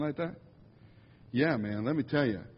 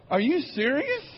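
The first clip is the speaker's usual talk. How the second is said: incredulous question; 10 semitones higher than usual. Retold or imitated. Retold thought about a warning label.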